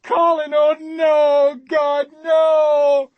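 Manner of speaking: high, loud shrieking despair; anguished scream. Acted. Woman screaming in the narrator's ear as Colin dies, anguished scream. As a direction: scream as the worst comes true. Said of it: "The woman is screaming in my ear".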